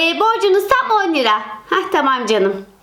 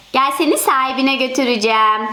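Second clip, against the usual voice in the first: normal talking voice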